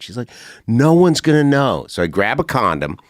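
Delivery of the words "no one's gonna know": higher, louder teasing woman's voice; hammed-up tempting lady; mock coaxing woman. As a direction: egg him on like a daring woman. Retold stripper persuading him.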